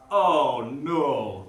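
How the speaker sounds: a lightly voiced deep baritone; a hint of a slow, grave movie voice; James Earl Jones voice. Host imitates James Earl Jones in the film, lightly voiced. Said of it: "Like James Earl Jones' voice".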